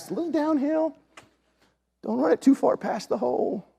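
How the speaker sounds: high, thin-voiced careful warning; retold cautious coaching. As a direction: advise him gingerly in a high voice. Nervous caution to son.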